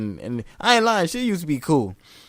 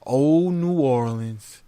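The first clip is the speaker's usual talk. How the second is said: slow, drawn-out, wistful Oh, New Orleans; slow, dreamy sigh; much slower than usual. Retold or imitated. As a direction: savor it like an old memory. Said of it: "He was like".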